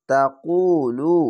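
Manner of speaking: drawn-out chanted reading of তাকুলু, vowels held long; slow Quran recitation. Shown as a demonstration. Teacher demonstrating tajweed to students, slow chanted recitation. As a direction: recite তাকুলু slowly, stretching each vowel. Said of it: "এক আলিফ টেনে পড়বেন".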